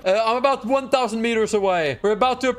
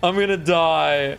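usual speaking voice

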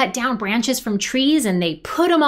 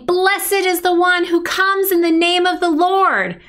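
raised, high-pitched proclamation; crowd shouting; 5 semitones higher, 4 dB louder than usual. Acted. Storyteller as the crowd praising Jesus, shouting. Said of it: "They were shouting".

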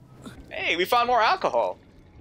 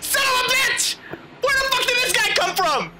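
startled cursing; 11 semitones higher than usual. Real, not acted. Ambushed by a monster.

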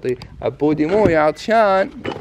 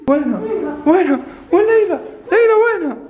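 calling out; 10 semitones higher than usual. Pretend. Frantic search calling daughter.

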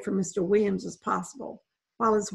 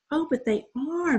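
higher, tender coo over kittens; 7 semitones higher than usual. A narrator's reading. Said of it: "Mandy cooed".

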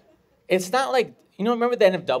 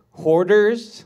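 loud, higher one-word announcement; acted-out proud woman; 6 semitones higher, 9 dB louder than usual. Woman naming her reality show, loud proud announcement.

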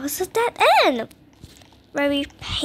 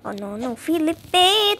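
usual speaking voice

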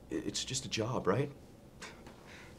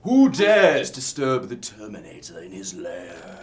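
dragon voice; 11 dB louder, slower than usual. Pretend. Booming villain joke to coworker.

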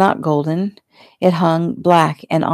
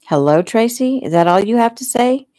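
higher, questioning echo of a greeting; affronted echo; 6 semitones higher than usual. A narrator's reading. Fiancée answers Toby's brief hello on the phone, affronted. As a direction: throw the greeting back as an indignant question.

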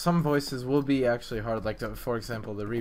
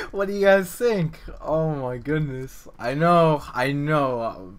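higher and louder, buzzing with excitement; excited; 3 semitones higher, 7 dB louder, slower than usual. Real, not acted. Vlogger asking viewers about his voice clip, excited and loud. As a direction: gush to the viewers, thrilled.

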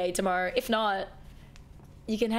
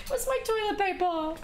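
laughing; 11 semitones higher than usual. Real, not acted. Still laughing, hunting tissues.

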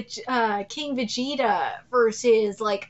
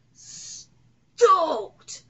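higher, explosive one-word cheer; stoked outburst; 4 semitones higher than usual. Real, not acted. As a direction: burst out with drawn-out excitement. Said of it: "I am stoked".

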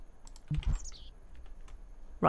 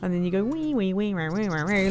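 singsong wee wee for the drops; childlike ride noises; playful wee. Retold or imitated. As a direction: bounce along like riding the coaster. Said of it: "narrating ride airtime".

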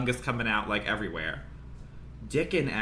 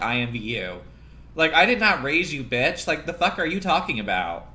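usual speaking voice

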